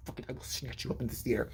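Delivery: low, fast, quiet threat; hushed threat. Retold or imitated. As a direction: threaten a kid under your breath in a theater. Bad parent threatening child in theater.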